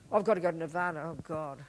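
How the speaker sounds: low, downcast mock groaning complaint; glum reluctance. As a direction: voice a guilty seeker, low and fading. Voicing a guilty, reluctant seeker.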